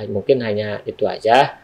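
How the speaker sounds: ordinary talk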